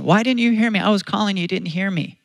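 lightly acted upset little boy; venting child. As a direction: imitate a kid protesting to parents. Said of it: "And then he's venting".

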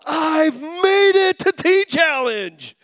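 high, loud, slow mock proclamation of triumph; mock proud newcomer. Preacher mocks newcomers arriving proud, high and loud. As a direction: ham up a proud announcement. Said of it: "Most of them don't show up in the program going".

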